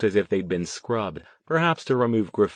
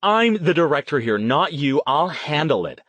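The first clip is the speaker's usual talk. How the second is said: raised, higher, angry insistence; pissed-off; 6 semitones higher, 4 dB louder than usual. A narrator's reading. Director defending her job to Larry, pissed-off. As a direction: assert your authority angrily. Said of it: "She looked as pissed as he did".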